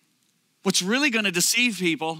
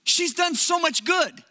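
pleading; 8 semitones higher, slower than usual. Retold or imitated. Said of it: "And I said".

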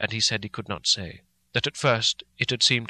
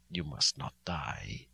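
much lower, softer and slow; hushed solemn; 8 semitones lower, 9 dB quieter, slower than usual. A narrator's reading. Van Helsing pleading softly with Mina not to die. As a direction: breathe it as a grave plea. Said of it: "as he said solemnly".

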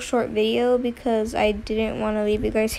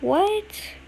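high startled what; shocked disbelief; surprised; 9 semitones higher than usual. Real, not acted. NPC left her behind.

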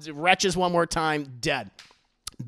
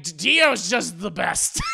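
slow, louder gushing; doting father; 4 dB louder, slower than usual. Retold or imitated. Lord Joestar praising Dio, slow gushing voice. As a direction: gush over him slowly.

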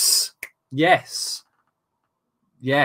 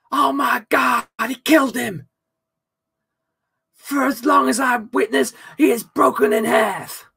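higher, hyped mock wrestling commentary; wrestling commentator; 7 semitones higher than usual. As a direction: scream it like a ringside announcer. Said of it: "wrestling commentary Mankind Hell in the Cell".

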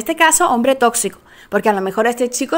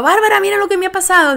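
higher, quick rush of distress; upset friend; 7 semitones higher, faster than usual. Retold or imitated. Friend calls Bárbara after the breakup, hurried and higher. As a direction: imitate the friend blurting it out fast.

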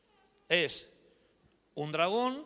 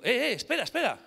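excited stop; 7 semitones higher, 4 dB louder than usual. Retold or imitated. Retold own sudden realisation.

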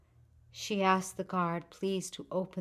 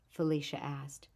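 plain reading voice

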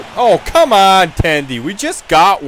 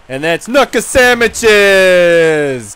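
drawn out and louder, hammed-up tough guy; slow loud boast; 6 dB louder, much slower than usual. Hockey goon bragging to the camera, slow hammed-up shout. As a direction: stretch each word like a goon showing off.

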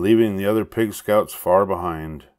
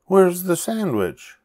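pleading; 11 semitones higher than usual. A narrator's reading. Pinkerton begs.

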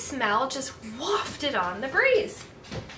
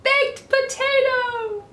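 high, loud, drawn-out call; 12 semitones higher, 6 dB louder, slower than usual. A narrator's reading. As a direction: call the dog in from down the street, stretching it out. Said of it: "he could hear her calling".

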